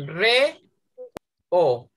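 Re, then o, said as two separate beats; split into syllables. Shown as a demonstration. Teacher showing the class two syllables. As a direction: break reo apart syllable by syllable. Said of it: "¿cuántas sílabas tiene?".

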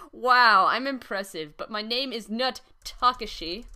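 ordinary talk, nothing marked

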